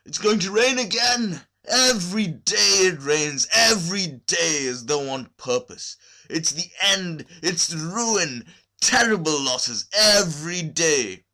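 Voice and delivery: loud, high, drawn-out wail about the rain; despairing lament. A narrator's reading. Kukin complaining to the sky about rain, loud despairing wail. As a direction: howl at fate like a ruined man. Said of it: "he cried in despair".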